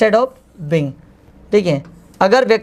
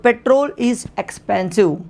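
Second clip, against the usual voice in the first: slow dictation; 2 semitones higher, much slower than usual. Shown as a demonstration. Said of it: "petrol महंगा है".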